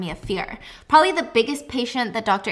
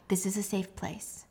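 soft whisper, gently reassuring; whispered comfort; 2 semitones lower, 9 dB quieter than usual. Retold or imitated. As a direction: hush her like a calm therapist. Therapist soothing sobbing patient.

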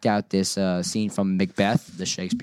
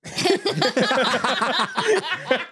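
long, high-pitched laughing fit; breathless high giggling; helpless laughter. Real, not acted. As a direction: crack up completely, up high. Cracking up at own accent.

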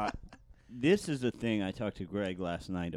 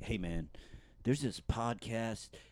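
hint of a hesitant junior analyst; lightly acted, measured suspicion; junior CIA investigator; 6 dB quieter than usual. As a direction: report a puzzling find to your superior, slowly and softly. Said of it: "like a junior, like, computer investigator at Langley".

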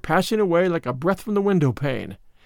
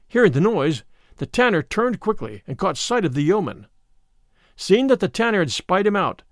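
ordinary delivery

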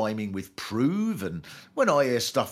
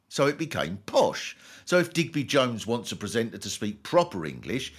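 ordinary talk

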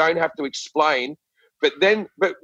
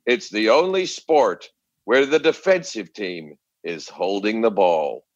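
low, slow, measured narration; documentary narrator; 7 semitones lower, slower than usual. Retold or imitated. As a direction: intone it like a solemn documentary. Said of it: "they go".

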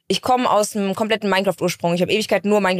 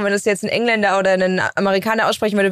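ordinary talk, nothing marked

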